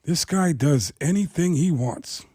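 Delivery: eyeball him and draw it out; Al Pacino impression. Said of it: "Al Pacino's looking at him going".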